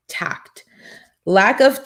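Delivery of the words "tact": "tact" whispered, voiceless and soft. Shown as a demonstration. Repeating "tact" to the audience in a whisper. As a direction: breathe the word "tact" out in a whisper.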